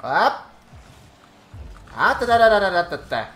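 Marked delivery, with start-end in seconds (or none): 2.0-3.3 sung fanfare, high and loud, then fading; bright ta-da fanfare. Pretend. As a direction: trumpet it like a victory fanfare. Celebrates a goal.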